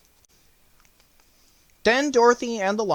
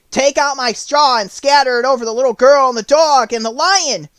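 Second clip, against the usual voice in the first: urgent Scarecrow; 8 semitones higher, 8 dB louder than usual. Acted. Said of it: "he said to the Woodman".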